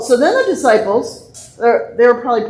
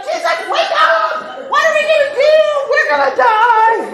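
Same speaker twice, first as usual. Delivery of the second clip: very high, hammed-up panic; panicked disciples. Disciples beg sleeping Jesus to wake, mock panicked yelling. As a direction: wail it in exaggerated alarm.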